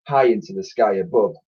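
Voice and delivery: plain reading voice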